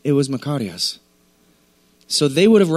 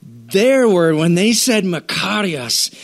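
ordinary delivery

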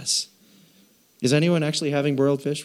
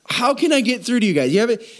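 exasperated; 7 semitones higher, faster than usual. Retold or imitated. Jesus frustrated with disciples.

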